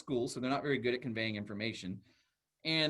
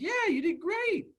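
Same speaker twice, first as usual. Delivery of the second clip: put-on very high, slow cheer for a child; encouraging praise; slower than usual. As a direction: gush like praising a little kid.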